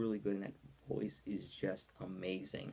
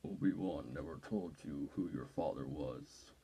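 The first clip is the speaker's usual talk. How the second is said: much lower, slow Vader voice sinking into breath; slow, low menacing Vader, fading to a whisper; Darth Vader impression; 6 semitones lower, 3 dB quieter than usual. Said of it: "I can do an impression of him right now".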